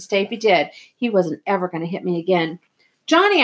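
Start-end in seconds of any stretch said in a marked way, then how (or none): 3.1-3.4 high, loud call; sharp raised-voice shout of a name. A narrator's reading. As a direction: call to wake a friend urgently. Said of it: "I called".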